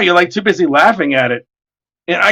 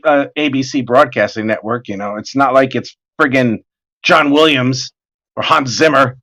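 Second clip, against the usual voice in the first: ordinary talk, nothing marked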